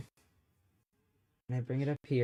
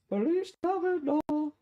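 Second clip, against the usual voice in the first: slow, very high chipmunk voice; helium voice; 4 dB quieter, slower than usual. Shown as a demonstration. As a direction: sing it like a sped-up chipmunk. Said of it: "[name] episode".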